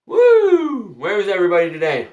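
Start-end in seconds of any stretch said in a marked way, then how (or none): 0.1-0.9 loud, high excited cheer; excited whoop. Real, not acted. Streamer greets the empty chat with an excited woo. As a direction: let out a big woo.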